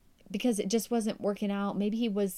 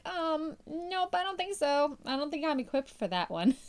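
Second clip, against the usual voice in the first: higher, mock-reluctant hedging; reluctant excuse; 6 semitones higher than usual. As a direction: play the nervous excuse to God, lightly. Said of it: "we're like".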